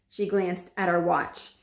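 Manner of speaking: ordinary delivery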